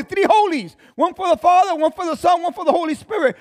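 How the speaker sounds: high, loud preaching push; fiery preaching. Acted. Preacher building the vision of the temple, full-force preaching. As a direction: drive it home to the congregation.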